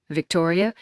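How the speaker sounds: plain reading voice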